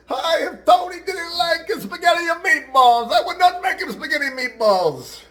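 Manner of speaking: very high, loud, drawn-out mock lament; wailing Italian wife. Italian wife at the funeral, hammed-up wailing. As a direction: play a distraught Italian widow, way over the top. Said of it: "And the Italian wife goes".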